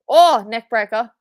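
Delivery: loud, slow thrilled call of the move; real fan excitement; excited call. Real, not acted. Reacting to live wrestling move.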